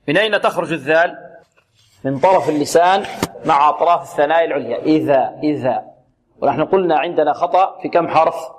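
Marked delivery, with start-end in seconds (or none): none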